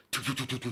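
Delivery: high, rapid stuttering jingle noise; imitated radio jingle. Imitating what blared from the plumber's van, mock jingle. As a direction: mimic a station's electronic intro sound. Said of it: "but I hear this".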